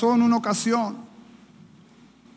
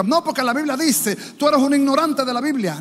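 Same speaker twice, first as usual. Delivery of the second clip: mock lecturing; 7 semitones higher than usual. Believer lecturing his mother, mock scolding. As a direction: scold like a smug Bible thumper. Mocking preachy child.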